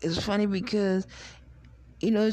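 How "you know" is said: normal talking voice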